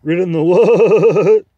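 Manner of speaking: the horse's indignant repeat, horsier; angry horsey accent. Acted. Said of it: "more horsey in accent when they are angry".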